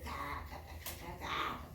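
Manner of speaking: soft, breathed mock chuckle; acted rabbit laugh. Rabbit hops up and starts laughing at the frog, mock laughter. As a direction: play a little bunny's quiet ha-ha laugh. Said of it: "empezó a reírse".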